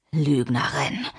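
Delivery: low, restrained accusation; menacing accusation. Acted. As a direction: say it low, as a threat. Man accusing Mimi before hitting.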